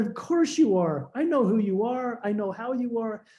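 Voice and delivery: mild amused quote, voice lifted; amused reassurance. Retold or imitated. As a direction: retell it as knowing, amused acceptance. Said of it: "She laughed at me".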